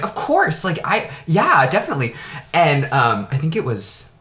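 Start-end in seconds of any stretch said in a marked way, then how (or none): 0.0-2.2 eager agreement. Retold or imitated. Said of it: "I was like".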